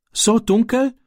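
high, frightened stammer; a scared boy stammers the question; stammering fear. A narrator's reading. Scared boy stammers at the farmer's wife, afraid of the dark. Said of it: "stammelte Ram".

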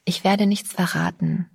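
ordinary delivery, nothing marked

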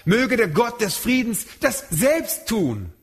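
slow, with "selbst" leaned on; the real verse, weighted on "selbst"; stress on selbst. Shown as a demonstration. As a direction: land on "selbst" slowly and firmly. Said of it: "emphatisch, also zur Betonung".

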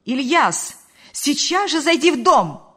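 shouting an order to come home; angry shouted call. A narrator's reading. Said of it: "крикнула она".

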